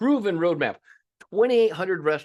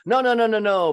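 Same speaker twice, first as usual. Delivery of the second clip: loud, high repeated no; emphatic no; 8 semitones higher, 7 dB louder than usual. Real, not acted. Coach rejecting owners' excuse, loud and high. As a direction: shut the idea down hard.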